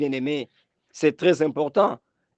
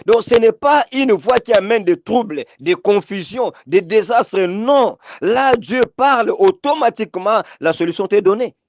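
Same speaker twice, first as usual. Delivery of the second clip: raised, forceful sermon delivery; higher-pitched preacher's exhortation; emphatic preaching; 5 semitones higher, 4 dB louder than usual. Real, not acted. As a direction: preach with insistent, punched phrases.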